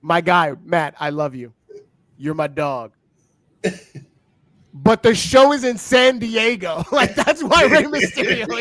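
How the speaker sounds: a fond friend's chiding, building to a slow, loud punchline; mock scolding. Telling his friend Matt the obvious, fond then slow and loud. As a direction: let him down gently, then land it. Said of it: "I go".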